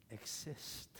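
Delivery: hushed whisper on exist; awed whisper of exist. Acted. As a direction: breathe the word out, no voice. Dramatic voicing of Jesus.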